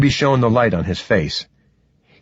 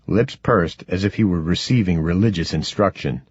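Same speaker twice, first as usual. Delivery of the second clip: plain reading voice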